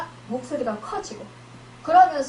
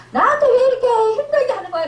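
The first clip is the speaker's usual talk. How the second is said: higher, louder, fast, with a half-laughing cry; whiny resentful complaint; 9 semitones higher, 6 dB louder, much faster than usual. Acted. Believer blaming the other person, fast high whining complaint. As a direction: whine it out, half laughing, half crying. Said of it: "이러면서 상대방을 원망했다면".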